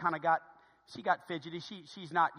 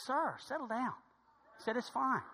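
soft, higher-pitched reassurance; calming stranger; 6 semitones higher, 6 dB quieter than usual. Retold or imitated. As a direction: imitate gently settling a frantic man. Stranger calms the panicking father.

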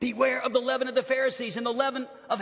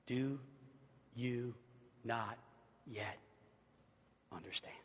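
soft, each word parted by pauses; slow, word by word; 10 semitones lower, 17 dB quieter than usual. Acted. Jesus asks his disciples slowly, soft, word by word. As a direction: let each word hang.

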